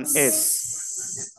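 es ending in a long hissed S; cedilla as S. Shown as a demonstration. Teacher answers how cedilla sounds, es with prolonged S. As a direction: draw out the S of es. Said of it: "cómo se pronuncia la C cedille".

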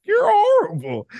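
very high squeaky voice, indignant; squeaky mock seal voice. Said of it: "seal voice quote".

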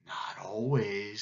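slow, low, whispered snake voice; whispery python. A narrator's reading. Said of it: "said Python".

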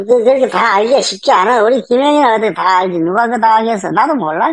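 usual speaking voice

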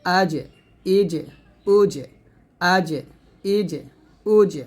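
আজ, ইজ, উজ with an echoing J release; mid-tongue on the palate, bounce the J of আজ, ইজ, উজ; qalqalah on Jeem. Shown as a demonstration. Teacher shows students bouncing J in আজ, ইজ, উজ, qalqalah. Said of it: "কলকলা করতে হবে".

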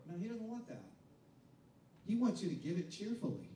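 normal talking voice

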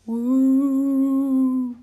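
loud, high spooky ghost wail; ghostly moan. A narrator's reading. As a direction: wail like a ghost haunting the woods. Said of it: "low moan sounded, rattling ominously".

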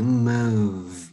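slow "move" with a held V; final V sound. Shown as a demonstration. Teacher explaining chữ move before âm đờ, held V in "move". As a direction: stretch "move" so the closing V hums. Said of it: "âm".

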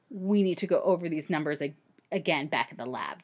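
low, brisk expert explaining; Doctor Gourd voice. Acted. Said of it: "Doctor Gourd explained".

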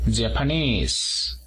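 "Japanese" slowly, stressing the final -NESE; stress on third syllable. Shown as a demonstration. Teacher models "Japanese" for Spanish speakers, stress on third syllable. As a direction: lean on the last syllable of "Japanese", drawn out. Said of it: "en la tercera sílaba".

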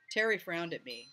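plain reading voice

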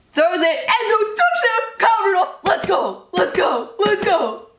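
high-pitched excited screaming call; ecstatic touchdown call. Real, not acted. Fan calling a touchdown for their team, ecstatic shouting. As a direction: celebrate the score in bursts, voice shooting up.

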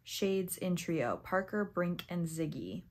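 ordinary talk

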